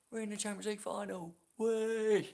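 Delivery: high celebratory chant rising into a loud whoop; excited cheer. Real, not acted. Fan celebrating Liverpool in the Champions League final, excited cheer. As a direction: cheer it out high, stretching the last word loud.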